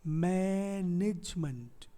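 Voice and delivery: मैनेजमेंट stretched as maaa-nij-munt; slow teaching model of मैनेजमेंट; three-syllable breakdown. Shown as a demonstration. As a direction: say मैनेजमेंट slowly with a long first syllable. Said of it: "और यहाँ हो गया मंट".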